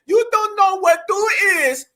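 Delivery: high, loud Spanish-accented imitation; mocking the lady's accent. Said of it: "this lady right here saying that".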